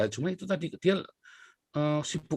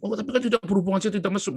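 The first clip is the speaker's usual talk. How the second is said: high, loud whiny excuse voice; a pitched-up defensive plea; mock excuse; 9 semitones higher, 4 dB louder, faster than usual. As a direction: act out the guilty spouse protesting innocence. Excuse of a cheating spouse.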